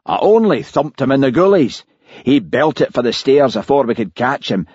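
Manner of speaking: a hint of an indignant Scottish cop; Blair on tape. A narrator's reading. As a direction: complain like a wronged bully. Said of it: "came Blair's voice".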